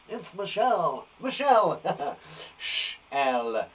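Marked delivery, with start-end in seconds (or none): 0.1-1.1 Michelle, with the shell sound stretched; teacher punning on the shell sound; playful name pun. Shown as a demonstration. 1.2-2.2 Michelle, with the shell sound stretched; teacher punning on the shell sound; playful name pun. Shown as a demonstration.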